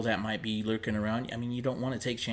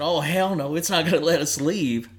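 higher, louder frightened outburst, half joking; choked-out alarm; 6 semitones higher, 9 dB louder than usual. Retold or imitated. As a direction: blurt it out scared but trying to joke. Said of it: "But I choked out and said".

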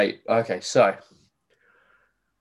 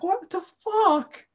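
high, very slow what the fuck; drawn-out disbelief; much slower than usual. Retold or imitated. Friend reliving the taxi, high and very slow disbelief. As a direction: stretch it out high in stunned disbelief. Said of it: "I'm like".

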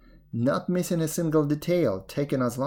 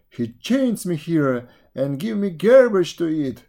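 loud, higher, bitter buffalo voice; 5 semitones higher, 5 dB louder than usual. A narrator's reading. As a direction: complain loudly as the ill-used buffalo. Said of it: "answered in a loud voice".

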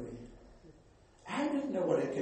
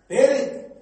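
sudden loud punch; 9 semitones higher, 11 dB louder than usual. Acted. Pastor warning of God's judgment.

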